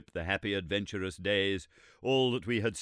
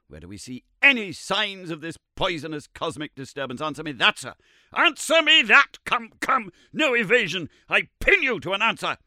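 from pointed question to full shout, much higher and louder; building indignant shout; 11 semitones higher, 11 dB louder than usual. Acted. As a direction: corner him and pin him down. Summerlee demanding an answer, rising.

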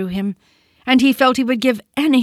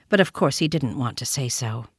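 ordinary delivery

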